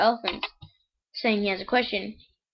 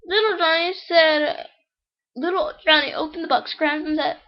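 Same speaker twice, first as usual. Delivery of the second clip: higher-pitched, measured read-aloud voice; joke storytelling; 6 semitones higher than usual. Acted. Reads the next joke aloud.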